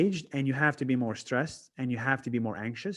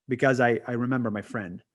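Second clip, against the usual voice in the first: usual speaking voice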